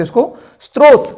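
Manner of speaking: स्रोत said as "stro-t" with a t sound; wrong reading स्रोत. Shown as a demonstration. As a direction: read स्रोत the common wrong way, "strot". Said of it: "त्रय को ऐसे लिखने से ये भ्रम पैदा हुआ है".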